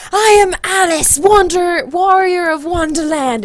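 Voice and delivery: high, loud, grandly slowed declaration; epic heroine. Retold or imitated. Retells her epic self-introduction.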